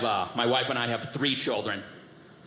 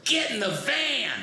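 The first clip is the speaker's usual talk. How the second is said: drawn-out retold holler, pitched up; super loud yell; 11 semitones higher, slower than usual. Dad yelling to his kids in a parking lot, super loud. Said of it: "Just super loud".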